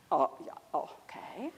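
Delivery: a startled, breathy whispered reply; hushed whisper. Retold or imitated. Scolded men answering the boy, surprised whisper.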